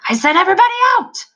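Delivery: very high, fast, loud demon scream. A narrator's reading. Demon screaming at the soldier, high and fast. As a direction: shriek it in a tantrum. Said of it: "screamed the demon".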